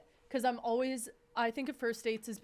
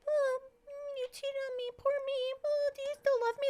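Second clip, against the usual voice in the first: high, whiny mock pity; mock a self-pitying victim in a high voice. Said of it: "I was like".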